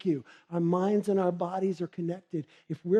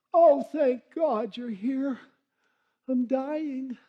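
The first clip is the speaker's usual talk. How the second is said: put-on dramatic old woman; 7 semitones higher, slower than usual. Elderly patient greets minister dramatically.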